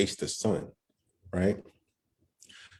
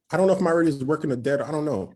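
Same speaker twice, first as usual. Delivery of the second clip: panicking; 7 semitones higher, faster than usual. Retold or imitated. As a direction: gabble it out in a panic. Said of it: "I'm panicking".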